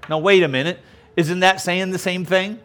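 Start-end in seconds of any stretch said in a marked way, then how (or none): none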